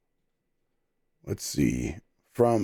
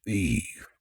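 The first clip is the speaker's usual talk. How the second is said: low gravel growl on one word; gravelly voice; 4 semitones lower, 6 dB quieter than usual. Shown as a demonstration. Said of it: "Can we get a gravelly voiced beef?".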